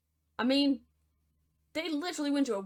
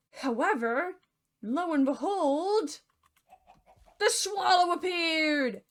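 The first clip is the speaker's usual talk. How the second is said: slow, higher dramatic announcement; grand reveal; 5 semitones higher, slower than usual. A narrator's reading. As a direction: draw it out like a big reveal.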